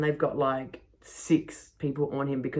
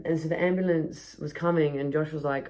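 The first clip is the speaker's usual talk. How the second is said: usual speaking voice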